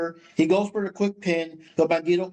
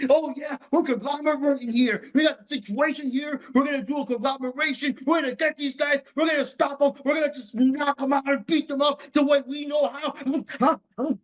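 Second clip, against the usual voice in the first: Briscoe promo voice; 7 semitones higher than usual. Retold or imitated. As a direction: ham up a crazy wrestler promo. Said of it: "the best part of Briscoe is just the promos".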